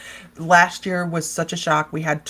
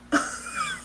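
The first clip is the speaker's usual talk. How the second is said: a squeaky giggle way up high; high squealing laugh; 5 dB quieter than usual. Real, not acted. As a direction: squeal with delight.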